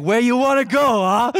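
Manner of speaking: high put-on New York cabbie accent; gruff New York taxi driver accent. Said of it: "pick up a New York accent".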